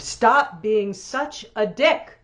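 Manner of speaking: slow, high, loud proclamation with arms flung wide; hammed-up divine thunder, fading with each repeat; booming mock voice of God. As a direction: boom it out like God from on high, then let it die away. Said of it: "she threw her arms wide and boomed".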